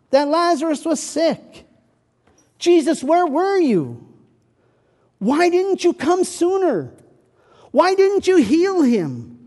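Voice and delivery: high, loud grieving plea; mourning sisters pleading with Jesus, anguished; anguished reproach. Acted. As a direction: cry out the sisters' hurt accusation.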